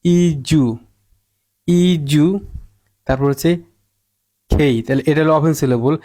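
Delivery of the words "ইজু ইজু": ইজু with ডিইউ said as "joo"; "ju" sound. Shown as a demonstration. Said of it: "জু উচ্চারিত হয়". (ইজু, ডিইউ-কে "জু" উচ্চারণে)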